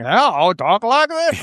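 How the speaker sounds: loud, drawn-out booming actor impression; high, slow, exaggerated cool voice; Matt Berry. Mimicking an actor's cool voice for friends, hammed-up and loud. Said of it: "can't really do a Matt Berry accent".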